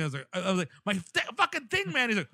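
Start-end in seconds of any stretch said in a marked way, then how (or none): 0.8-2.1 high, strained mock yell without full volume; retold screaming. Rider to attendant about the loose guard, mock screaming. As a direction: imitate a panicked shout, pitched way up. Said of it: "lose my fucking voice by screaming".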